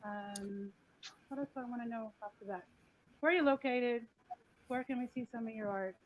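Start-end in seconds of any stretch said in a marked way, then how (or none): none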